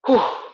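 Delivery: loud whew. Real, not acted. Exhale after a hard set.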